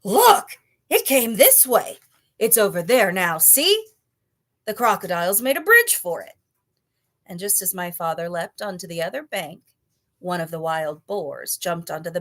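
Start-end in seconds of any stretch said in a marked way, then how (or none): 0.0-6.3 high, loud excited yell; boar screaming. A narrator's reading. Wild boar screaming to the other animals, loud high yell. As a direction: scream out to warn the crowd. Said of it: "he heard a wild boar scream".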